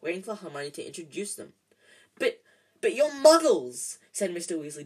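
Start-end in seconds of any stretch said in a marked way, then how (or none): 2.2-4.0 high, loud, slow amazement; delighted. A narrator's reading. Mister Weasley meets Hermione's parents, delighted. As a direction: marvel aloud with stunned delight.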